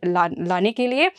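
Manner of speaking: ordinary talk, nothing marked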